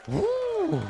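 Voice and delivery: very high "woo"; high-pitched exhaled whoop; high relieved whoop. Real, not acted. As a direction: let out a thin high whoo of relief. Relief after stopping the clip.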